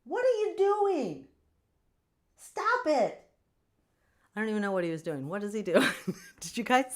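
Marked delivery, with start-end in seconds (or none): 0.1-3.3 high, loud sharp scold; startled high scold at the cat; scolding pet. Real, not acted. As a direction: snap at the pet to stop.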